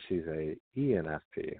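ordinary delivery

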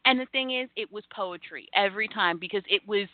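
ordinary talk, nothing marked

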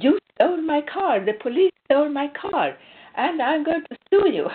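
hammed-up outraged shouting at police; aggressive accusation. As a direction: throw the accusation at the police the way he did. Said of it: "And he was so aggressive".

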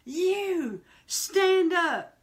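high, stern call to stand; a light take on a pastor's order; commanding pastor. Retold or imitated. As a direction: point someone out from the pulpit. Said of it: "he goes".

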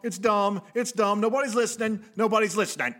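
loud, high, pounding wrestling promo. Acted. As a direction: hammer each line like a heel promo. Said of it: "promo, uh, cut one".

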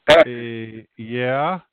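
low, loud hesitant uh yeah; sheepish mumble. Retold or imitated. Pastor caught off guard by woman, sheepish low answer. Sheepish reply in restroom story.

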